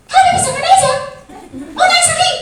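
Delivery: loud, high-pitched mother's threat; mock scolding mother. Lecturer imitates mother from TikTok clip, mock scolding. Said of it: "ada ibu-ibu anaknya nangis mau pipis".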